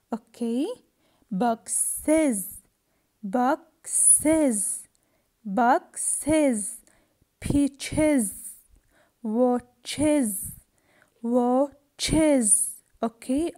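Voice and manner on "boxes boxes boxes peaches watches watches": "Boxes", "Peaches", "watches" split before a clear iz syllable; pause, then stress iz in "Boxes", "Peaches", "watches"; syllable-by-syllable model of "Boxes", "Peaches", "watches", ending in iz. Shown as a demonstration. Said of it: "I pronounce it with is".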